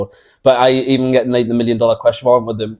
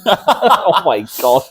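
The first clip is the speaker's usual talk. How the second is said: laughing; 7 semitones higher, much slower than usual. Real, not acted. Laughing at the joke.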